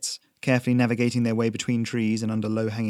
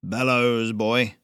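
curt, louder command; gruff old smith; 3 dB louder, much slower than usual. A narrator's reading. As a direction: bark the one-word order at the lad. Smith gives curt order.

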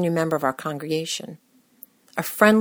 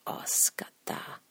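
slow whispered curse; hushed oh-no aside; dismayed inner thought; 13 dB quieter, much slower than usual. Retold or imitated. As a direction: whisper it to yourself in dread. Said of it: "and I thought".

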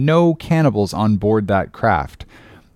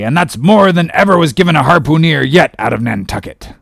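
high, loud blustering captain; hearty sea captain hollering; excited urging; 7 semitones higher, 5 dB louder than usual. A narrator's reading. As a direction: rattle out orders with eager gusto. Peleg excitedly orders hiring.

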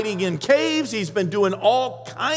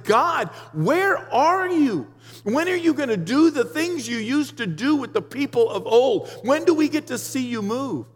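crying out to God; 6 semitones higher than usual. Retold or imitated. Preacher reenacting his prayers alone, pleading cry. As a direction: lift the voice, begging God. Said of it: "just crying out and saying".

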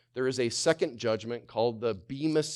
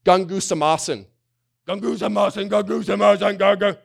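high, loud mocking mumble of the grumbling word; mock grumbling sound; 8 semitones higher, 10 dB louder than usual. Shown as a demonstration. Preacher mimicking the grumble word for his listeners, loud and mocking. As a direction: imitate grumbling noises with the Greek word. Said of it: "It's an onomatopoeia".

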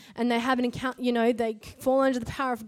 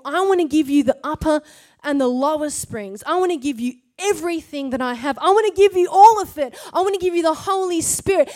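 louder and louder, pouring it out; rising impassioned offer; 2 semitones higher, 7 dB louder than usual. Acted. Jesus offering everything, voiced by the preacher, impassioned. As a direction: build it up to a near shout.

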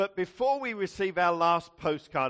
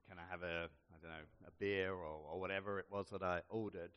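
timid murmur; 9 semitones lower, 13 dB quieter, faster than usual. Retold or imitated. Timid order at seedy bar.

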